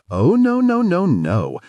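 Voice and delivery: high, loud run of no's; emphatic protest. A narrator's reading. River refusing to change plans, emphatic high no. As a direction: shoot the idea down fast and high.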